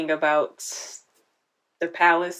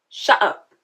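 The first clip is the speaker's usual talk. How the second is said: louder shut up; 4 semitones higher, 5 dB louder than usual. Shown as a demonstration. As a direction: repeat shut up louder and sharper. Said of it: "Oh my God, shut up".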